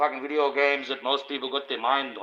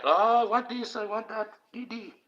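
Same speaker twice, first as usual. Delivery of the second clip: mocking eager fans; 10 semitones higher than usual. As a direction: ham up a greedy fan begging for the book. Mocking eager fans chucking money.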